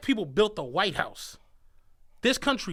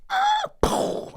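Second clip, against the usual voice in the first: high squeal into a puffed crash noise; vocal crash effect; imitated car crash; 4 dB louder than usual. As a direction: mimic a car backing into another. Imitates car backing into his.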